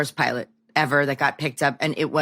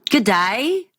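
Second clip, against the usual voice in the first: a quick, clumsy Australian "Good day"; failed Australian accent; 6 semitones higher, 3 dB louder than usual. Retold or imitated. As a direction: try the Australian greeting and miss it. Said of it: "I can't do that accent".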